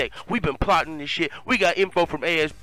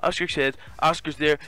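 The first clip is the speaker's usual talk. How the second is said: ordinary talk, nothing marked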